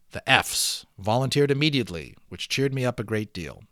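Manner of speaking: steady read-aloud storytelling voice; story narration. Acted. Teacher reading the balloon novel aloud to students, storytelling narration. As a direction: read it like a chapter book to the class.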